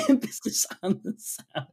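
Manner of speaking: high, laughing echo. Real, not acted. Host echoing the co-host's South Dakota joke, laughing. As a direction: repeat it through giggles.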